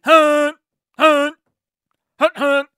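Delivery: high, short honks; imitated car horn. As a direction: toot like a car horn in repeated bursts. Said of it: "blast a horn at them".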